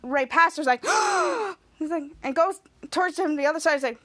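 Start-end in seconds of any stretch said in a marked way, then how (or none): 0.9-1.5 sharp high gasp of shock. Retold or imitated. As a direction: gasp as if appalled. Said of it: "Charlotte gasping".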